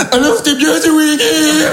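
high, slow sing-song mimicry of the boss; mocking echo. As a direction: parrot him back with a high, slow mocking lilt. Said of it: "Toi, tu peux répondre". (imitation moqueuse, aiguë et lente)

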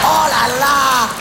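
slow, stretched laughing oh là là; drawn-out mock exclamation. Real, not acted. Mocking friend's outfit.

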